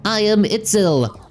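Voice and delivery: high, lilting robot helper voice; a gentle high machine voice; character voice. Acted.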